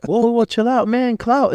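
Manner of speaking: high-pitched, hurried backing-off; acted alarmed placating. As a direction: imitate someone trying to calm a hothead. Other man calms his hostile reaction.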